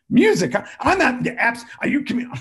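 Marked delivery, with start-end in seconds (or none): 0.1-0.6 high, loud startled question; loud high surprised "Music?". Real, not acted. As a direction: react in disbelief. Surprised echo.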